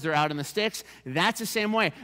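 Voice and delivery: ordinary delivery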